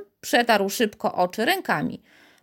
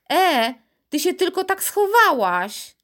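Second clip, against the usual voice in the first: high-pitched, raised call; 10 semitones higher, 4 dB louder than usual. A narrator's reading. Astonished Piotr calling to vanished Karolcia, shouting. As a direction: call out in disbelief. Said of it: "a potem zaczął wołać".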